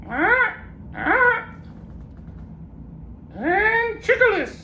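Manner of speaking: very high slow parrot garbling a long word. Acted.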